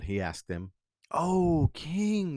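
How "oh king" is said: lightly acted adoring courtiers, pitched higher; fawning cry. A narrator's reading. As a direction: cry out to flatter the king. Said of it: "they cried".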